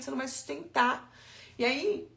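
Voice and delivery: ordinary talk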